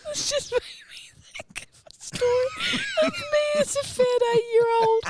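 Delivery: high, squeaky laughing speech; helpless laughter. Real, not acted. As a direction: struggle to talk through laughter. Cracking up before own story.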